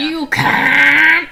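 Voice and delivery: loud, stretched-out insult; mock bot yell. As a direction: bellow it as the rude bot. Joins in mimicking rude bot.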